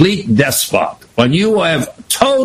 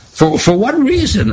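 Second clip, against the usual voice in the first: surprised question; 6 semitones higher than usual. Acted. Daniel questioning voiced.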